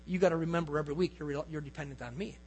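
low, quickened, fading very soft; gentle divine reminder. Retold or imitated. The Lord to the pastor about weekly dependence, low soft reminder. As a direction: quietly press home a tender reminder.

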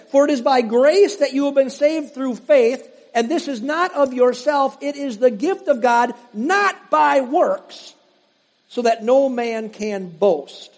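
preacher reading scripture aloud. Acted. Reads Ephesians aloud.

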